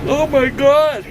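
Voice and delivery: Oh my God stretched out in a big real yawn, high and loud; a real yawn swallowing the phrase. Real, not acted. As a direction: yawn right through the words, slow. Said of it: "I don't know why I'm yawning".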